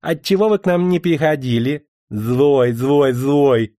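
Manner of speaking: lightly voiced teasing young ladies; playful scolding girls. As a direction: tease him like pouting girls. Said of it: "смеясь и перебивая друг дружку".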